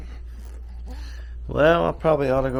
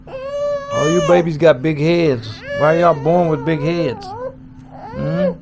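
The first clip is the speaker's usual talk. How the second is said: put-on higher, sing-song coaxing to a baby; baby talk; 11 semitones higher than usual. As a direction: coo encouragement at the little one. Parent cooing to crawling baby.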